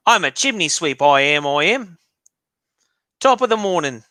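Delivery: hammed-up British chimney sweep accent, swinging high; mock British accent. Shown as a demonstration. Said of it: "How do I do the accent?".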